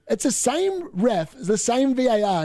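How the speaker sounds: same hit hard, slowed, falling; stress on same. Real, not acted. Fan ranting about the same referee and VAR, stressing same. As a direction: lean on the word same each time.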